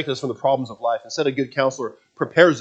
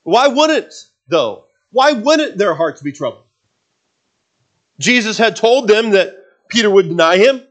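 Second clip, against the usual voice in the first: loud, higher, urgent sermon voice; full-force preaching; 9 semitones higher, 8 dB louder than usual. Acted. Preacher presses the question to the congregation, loud and urgent. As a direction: preach it out to the back row.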